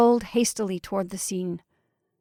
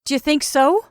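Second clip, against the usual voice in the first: loud, raised-pitch jibe; mocking retort; 6 semitones higher, 6 dB louder than usual. A narrator's reading. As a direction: toss it back mockingly. Sarcastic retort in quarrel.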